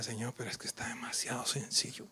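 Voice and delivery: soft whisper to God; whispered prayer. Retold or imitated. Pastor recalling his prayer to God, whispered. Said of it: "yo hasta decía".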